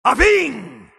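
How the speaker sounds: high, booming shout with falling tail; full shout. Acted. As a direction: blast each word out and let it drop. Isolated battle shouts in game.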